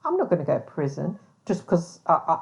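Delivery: ordinary talk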